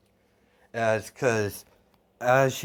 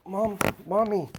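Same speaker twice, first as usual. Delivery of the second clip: put-on high little girl's voice, pleading; a small child calling to her dead mother; child calling mom; 11 semitones higher than usual. Little girl begging her mother to wake. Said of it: "And she's like".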